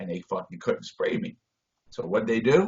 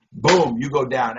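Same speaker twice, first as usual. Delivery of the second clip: ordinary talk, nothing marked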